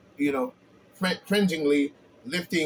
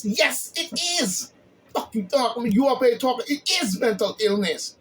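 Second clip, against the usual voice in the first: high-pitched heated rant against the critics; heated outburst; 7 semitones higher than usual. Real, not acted. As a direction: snap back at the critics, fired up. Fiery reply to Cruz's critics.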